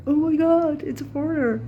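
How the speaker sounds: high, loud, squeaky dog voice in shock. Retold or imitated. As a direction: play a startled dog yapping at the newcomer. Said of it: "They go like".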